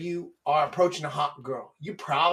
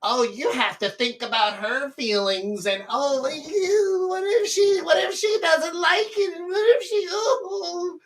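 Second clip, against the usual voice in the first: high, whiny fake crying; hammed-up wail rising higher; mocking soft-hearted worry; 12 semitones higher than usual. Mocking worries about her feelings, whiny wail. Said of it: "soft-ass P C bullshit of like".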